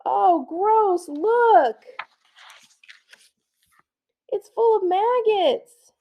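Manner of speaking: put-on high, loud squealing kids; disgusted kids. Schoolkids squeal at something gross in high voices.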